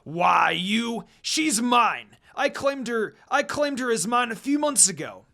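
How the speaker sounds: loud, high possessive snarl; angry growl. Acted. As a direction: snap it at him, claiming her. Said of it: "Koga growled at him".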